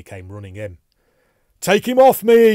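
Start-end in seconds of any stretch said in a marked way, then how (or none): 1.6-2.5 high, very loud desperate cry; frantic high-pitched yelling; panicked yell. A narrator's reading. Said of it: "he yelled".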